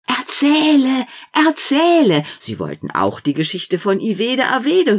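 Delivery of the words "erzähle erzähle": high, loud eager cry; children calling. Acted. Children clapping and calling for more, loud and high. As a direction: beg loudly like excited kids.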